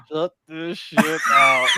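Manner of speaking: slow, loud mocking of the host; mock host snapping. Podcaster mocking the TV host telling him to stop. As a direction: drag out the host's rebuke, mocking. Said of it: "the host was like".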